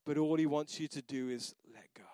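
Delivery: whispered, intimate plea; hushed whisper. Real, not acted. Pastor whispering to the congregation, hushed. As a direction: drop to a whisper to make them lean in.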